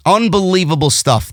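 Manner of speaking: ordinary delivery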